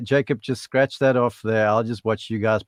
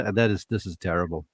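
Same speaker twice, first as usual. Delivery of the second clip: normal talking voice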